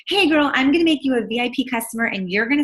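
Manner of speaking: a hint of a fake-friendly pitch; mocking a pushy seller. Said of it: "was basically just like".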